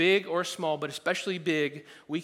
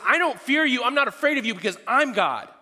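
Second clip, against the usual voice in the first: defiant sinner; 8 semitones higher, 9 dB louder than usual. Retold or imitated. Defiant attitude voiced.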